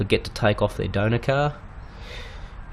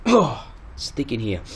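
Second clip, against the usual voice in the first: clearing a dusty throat; choked up by dust, throat catching; coughing on dust; 2 semitones higher than usual. Real, not acted. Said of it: "oh, I'm just choking on the dust".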